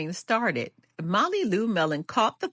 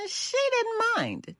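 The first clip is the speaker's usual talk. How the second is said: much higher, slow and sweet; high and light. A narrator's reading. Grandmother reading the refrain to child, high and slow.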